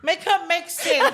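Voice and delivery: high, slow protest through laughter; laughing mock protest. Friend joking about a fit new mum, high laughing protest. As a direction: draw out the joke complaint, laughing.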